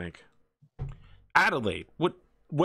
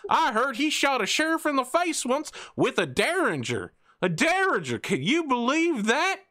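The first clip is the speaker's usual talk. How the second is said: very high, loud, twangy Texan yokel; 4 dB louder than usual. Acted. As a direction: whoop it up like a rowdy backwoods dockhand. GM voices Billy Bob.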